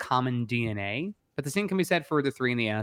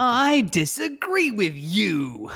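slow, drawn-out, higher-pitched playful objection; mock objection; 6 semitones higher, much slower than usual. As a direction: stretch every word into a teasing challenge. Playful objection to co-host's theory.

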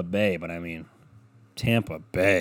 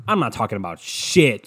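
usual speaking voice

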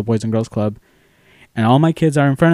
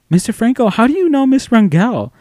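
put-on higher-pitched kid voice; imitated curious students; 9 semitones higher than usual. Students asking how he knows Miss Rangel, high and curious. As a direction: mimic children puzzled by their teacher. Students question their teacher.

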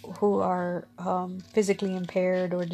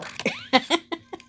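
bright high-pitched laugh; 9 semitones higher than usual. Real, not acted. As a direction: burst out laughing. Friends joking together.

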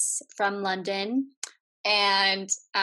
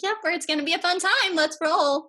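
high, loud, quick hype; hyped-up pep talk; 9 semitones higher, 6 dB louder than usual. Retold or imitated. Hosts talking the guest into the segment, hyped-up. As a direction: gee someone up with mock over-eager cheer.

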